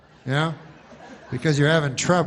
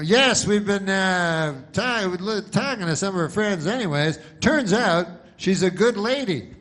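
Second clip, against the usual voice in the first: mock field reporter; 6 semitones higher, 3 dB louder than usual. Reporter sums up victim.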